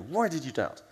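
frustrated demand for an explanation; scolding question with an emphatic swoop; angry tone. Shown as a demonstration. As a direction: demonstrate an exasperated telling-off. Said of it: "angry tone".

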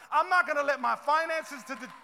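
preaching at full force. Acted. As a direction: build each I'm gonna speak life to a peak. Building declaration to the congregation.

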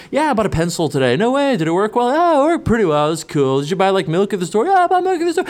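lightly voiced casual girls' chat, rising high; hint of mock girl chat. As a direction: play two girls making small talk. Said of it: "It's like".

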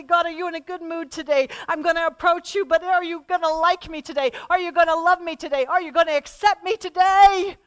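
nervously asking God's mood; anxious prayer. Retold or imitated. Asking God if he's in a good mood, a nervous plea.

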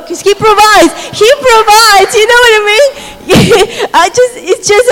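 high, loud, fervent exclaiming; joyful praise. Real, not acted. Preacher praising God's provision to the congregation, high joyful. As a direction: ring out the praise with rising joy. Testimony climax praising God.

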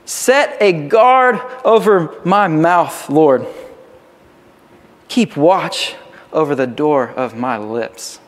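slow, weighty plea to God; crying out. Acted. Psalmist pleading with the Lord to guard his mouth, crying out. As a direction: cry it out slowly, word by word. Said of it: "cries out".

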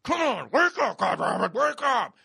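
loud, high, urgent yelling; desperate self-waking. Retold or imitated. Man forcing himself out of a nightmare, desperate yelling. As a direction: yell yourself awake in desperation. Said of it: "just go".

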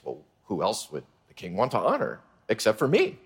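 smug Haman thinking. Acted. Haman thinks smugly to himself.

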